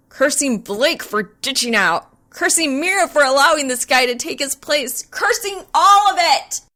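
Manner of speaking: cursing her luck until it bursts out as a shout; building to a yell. A narrator's reading. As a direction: work yourself up from grumble to yelling. Cursing mounting to outburst.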